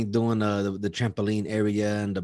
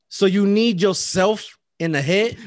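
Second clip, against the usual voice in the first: slow, loud, high rising question; incredulous questioning; 8 semitones higher, 8 dB louder, slower than usual. Retold or imitated. Man confirming with the kid what happened, incredulous. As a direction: spell it out in stunned disbelief. Acted disbelief at kid's answer.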